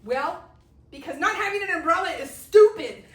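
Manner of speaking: loud, high laughing retort, all caps; blunt retort. Retold or imitated. Mother snapping at daughter about the umbrella, loud laughing retort. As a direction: blurt it out at full volume. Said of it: "what do I have to say but".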